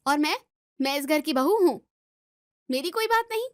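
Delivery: hurt indignation. Acted. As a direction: demand your own place in the house. Daughter-in-law demands to be heard. (आहत होकर ऊँची आवाज़ में विरोध)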